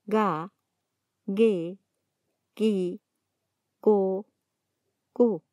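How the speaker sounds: ga, ge, gi, go, gu each with a hard g, one by one. Shown as a demonstration. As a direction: keep the g hard even in ge and gi. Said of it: "La G siempre se pronuncia".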